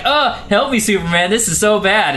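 high, half-hearted damsel plea; badly faked cry for help; Lois faking distress. Lois in the rapids to Superman, mock distress.

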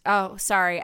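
usual speaking voice

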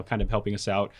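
ordinary talk, nothing marked

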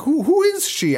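very high puzzled question; hammed-up confusion; mock bafflement. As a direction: squeak it out confused. His own confusion retold.

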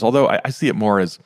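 ordinary delivery

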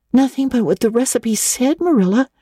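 high, anguished plea; anguished cry. A narrator's reading. Anne to Marilla, pleading in anguish. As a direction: cry out in dismay. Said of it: "cried Anne with a look of anguish".

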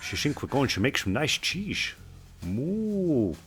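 soft moo in a mock Dutch accent; quiet, hammed-up Dutch cow voice. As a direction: play a Dutch cow with slushy "sh" sounds. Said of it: "the Dutch cows just stand in the field like".

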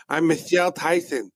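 high mock girly voice; a hammed-up woman impression; man doing a woman's voice.

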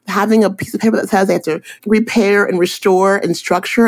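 acting out an excited, high-pitched lecture; mock impassioned plea to clients; excited insistence. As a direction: rattle it off high, worked up about the subject. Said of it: "I get really excited too".

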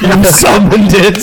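hammed-up possessed warning; mock demon voice.

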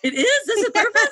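stunned and delighted by a friend's answer; laughing disbelief. Retold or imitated. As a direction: laugh it out, amazed at the news. Friend says that's purpose.

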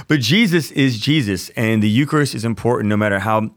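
usual speaking voice

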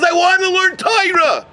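high, impassioned repeated plea; tearful insistence, acted. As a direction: cry out the wish without sobbing. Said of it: "And then he's crying".

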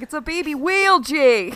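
high-pitched mock Boston accent. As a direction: imitate an excited Boston man on a boat. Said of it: "talks about the Boston accent".

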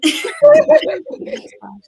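very high, loud delighted laugh; high squealing laugh. Real, not acted. Guest laughing in delight at the host's phrasing. Laughs after praising Auntie Sade.

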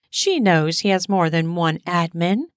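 plain reading voice

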